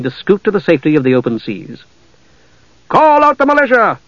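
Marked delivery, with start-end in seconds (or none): 2.9-4.0 ordering. A narrator's reading. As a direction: bark the order to muster troops. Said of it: "ordered the governor".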